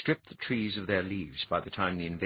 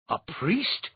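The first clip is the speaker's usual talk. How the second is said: a sharp, higher-pitched "A priest?"; incredulous; 10 semitones higher, 4 dB louder than usual. A narrator's reading. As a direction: demand it in disbelief. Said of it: "Twengor demanded incredulously".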